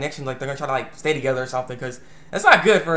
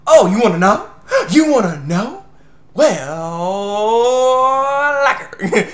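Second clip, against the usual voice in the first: higher, louder, taunting lilt, slow at the end; teasing; 7 semitones higher, 7 dB louder, much slower than usual. Retold or imitated. Himiko in Taichi's body taunting Fujishima, high teasing. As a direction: tease them with it. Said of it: "inside Tachi's body's like".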